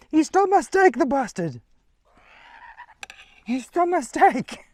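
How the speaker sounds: shocked high shrieking; adrenaline rush. Real, not acted. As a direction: yelp in disbelief, loud and high. Bird steals steak, shrieking.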